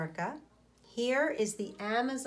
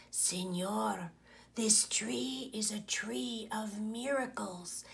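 snake whispering into the ear; hissing whisper; 5 dB quieter, slower than usual. Acted. Boa constrictor hissing to the sleeping man, soft whisper. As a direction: lean in and hiss it softly like a snake. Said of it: "hissed in his ear".

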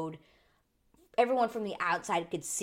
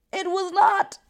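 higher and much louder, slowing down on the winners; excited outburst; 5 semitones higher, 11 dB louder, slower than usual. Real, not acted. Telling viewers who won, a loud excited outburst. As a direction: burst out with the news.

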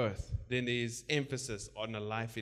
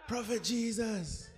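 slow, higher mocking "Prophet Jesus" with a laugh; mock formal title; 10 semitones higher, slower than usual. Shown as a demonstration. Preacher mocking people who call Jesus prophet, slow and high. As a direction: drag out the stiff title "Prophet Jesus" in mockery. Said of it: "Not Prophet Jesus".

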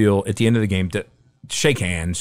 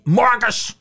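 calling out "Marcus!" much higher than his usual voice; sharp call; 13 semitones higher than usual. Retold or imitated. Coach summoning his quarterback, sharp high call. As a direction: snap the player's name out, high and exasperated.

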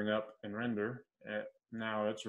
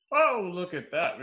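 mock surprise; 8 semitones higher, 10 dB louder than usual. Real, not acted. Error pops up on screen.

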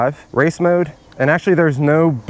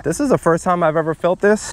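ordinary delivery

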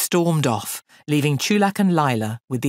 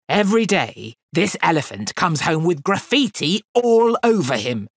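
loud, higher exasperated complaint; angry shout; 4 semitones higher, 5 dB louder than usual. A narrator's reading. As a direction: shout it at the careless boy. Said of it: "shouted the elephant master".

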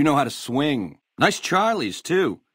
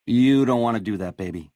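ordinary talk, nothing marked

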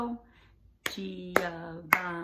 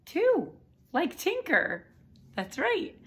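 much higher, loud cheer; excited praise; 11 semitones higher, 8 dB louder than usual. Shown as a demonstration. Teacher cheering the class, much higher and louder. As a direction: squeal the answer with delight.

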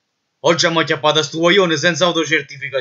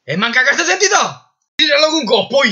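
angry yell; 11 semitones higher than usual. Acted. Marshal yells at the detainee.

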